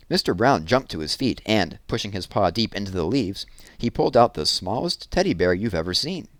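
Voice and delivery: usual speaking voice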